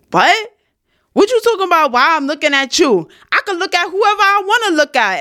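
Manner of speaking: imitating an indignant man, pitched very high; high-voiced, defensive challenge, acted out; confrontational retort. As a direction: mimic him firing back, voice shooting up. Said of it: "gonna serve it up to me like that".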